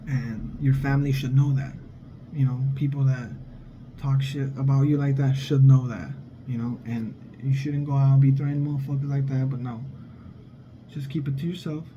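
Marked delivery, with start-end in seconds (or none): none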